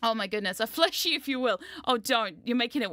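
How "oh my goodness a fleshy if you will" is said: ordinary delivery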